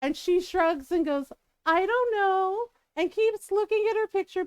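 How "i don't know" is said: slow, higher-pitched shrug of a reply; feigned innocence. Retold or imitated. Youngest asked where her sister is, feigning innocence. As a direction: play a child acting oblivious.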